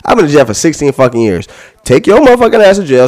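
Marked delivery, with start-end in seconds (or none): 1.8-3.1 angry demand. Real, not acted.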